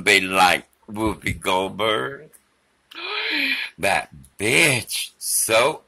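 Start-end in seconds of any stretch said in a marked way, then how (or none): 3.0-3.7 high, hammed-up gasp; mock gasp. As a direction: gasp in fake outrage.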